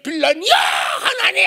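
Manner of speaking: high shouted protest; indignant outcry. Retold or imitated. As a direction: call God out. Wife protests to God. (하나님께 따지듯 높게 외쳐)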